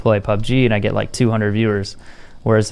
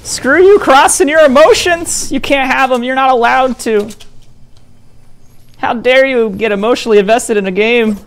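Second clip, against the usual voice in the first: mocking voice; 12 semitones higher, 5 dB louder than usual. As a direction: parody an outraged scold. Mocking overreacting angry players.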